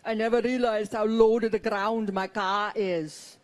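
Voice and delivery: put-on husband's heavy Austrian accent; thick mock Austrian accent. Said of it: "He talks like Arnold Schwarzenegger".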